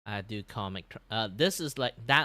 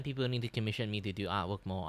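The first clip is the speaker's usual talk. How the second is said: normal talking voice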